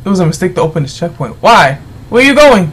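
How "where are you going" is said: loud, higher call after someone leaving. Real, not acted. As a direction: shout after him as he walks off. Entrant walks off, he calls after.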